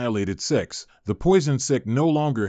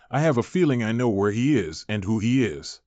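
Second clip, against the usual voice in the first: ordinary delivery, nothing marked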